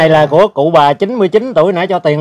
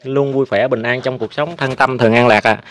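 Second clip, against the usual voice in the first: formal outro; 3 semitones lower than usual. Acted. Formal thank-you outro to viewers.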